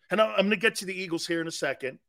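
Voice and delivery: normal talking voice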